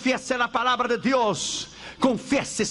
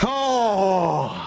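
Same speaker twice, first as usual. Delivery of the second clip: anguished cry; 5 semitones lower than usual. Retold or imitated. A cry in the story.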